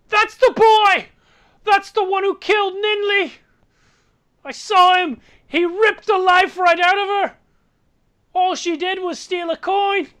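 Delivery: high, loud accusing shriek; innkeeper woman yelling. Acted. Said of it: "innkeeper woman yells".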